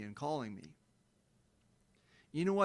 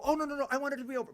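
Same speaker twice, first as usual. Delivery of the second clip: mock whiny protest; 11 semitones higher, much faster than usual. Mocking oppressors who want it over.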